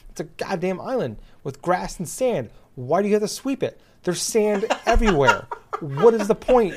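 worked-up, laughing indignation; exasperated rant. Real, not acted. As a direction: rant in disbelief at the absurd task. Ranting about a pointless game task.

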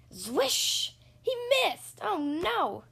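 ordinary delivery

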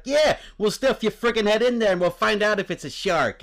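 loud, high-pitched Brooklyn tough-guy threat; hammed-up Brooklyn bruiser spoiling for a fight. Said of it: "Brooklyn tough guy".

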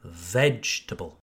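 "Vegetable" as VEJ-tə-bəl, low and falling; first-syllable stress. Shown as a demonstration. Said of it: "you emphasize the first syllable".

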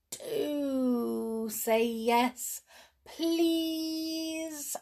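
very slow, high drawn-out plea; wheedle, stretching DO and PLEASE; begging. A narrator's reading. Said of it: "begged George".

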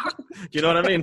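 laughter bursting out through the words; cracking up mid-sentence; real laughing. Real, not acted. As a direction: let a held-in laugh escape as you talk. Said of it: "I'm laughing inside and it's bursting to get out".

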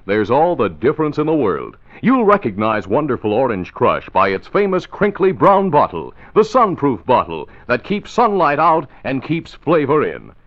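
bright, upbeat radio ad read; chipper 1950s ad. Acted. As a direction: sell it like a cheerful announcer. Announcer reads an ad.

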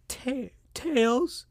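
put-on high, soft toothless girl voice; Amy calling. Amy turns to Tails asking for Shadow, high and soft. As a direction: timidly call his name, high.

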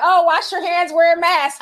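mock prissy warning. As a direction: imitate a fussy safety reminder. Mocking what viewers expected.

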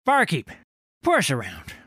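loud, high call across the tavern. Acted. Old sailor hailing the barkeep across the tavern, loud call. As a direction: hail the barkeep across the room.

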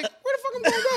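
high, laughing "where am I gonna go"; laughing at the absurdity; laughing disbelief. Retold or imitated. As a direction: crack up mid-question in disbelief. Retold disbelief at being kicked out.

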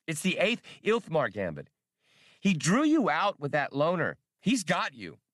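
high, eager little droid voice; childish droid voice. Acted. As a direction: chirp it like a know-it-all kid robot. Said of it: "in his childish voice".

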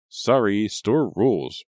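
loud, bored brush-off; bored dismissive. A narrator's reading. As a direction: yawn it out dismissively. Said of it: "yawning into her hand".

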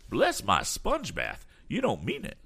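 high-pitched, drawn-out exclamation; astonished eccentric. Acted. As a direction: play a fussy eccentric who can't believe it. Damon astonished.

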